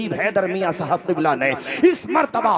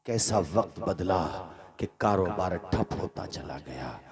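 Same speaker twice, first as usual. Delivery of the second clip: low, soft, grieving narration; hushed sad storytelling; 11 semitones lower, 7 dB quieter than usual. Acted. Preacher tells the ruined son's fall, hushed and grieving. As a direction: draw them into the son's downfall quietly.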